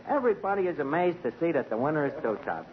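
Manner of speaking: usual speaking voice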